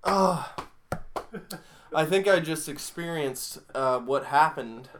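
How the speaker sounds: breathless after laughing hard; winded, recovering from laughter. Real, not acted. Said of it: "Let me compose myself".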